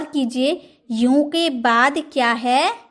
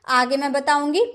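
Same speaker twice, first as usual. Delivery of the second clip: ordinary talk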